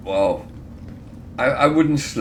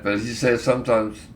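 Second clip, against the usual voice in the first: normal talking voice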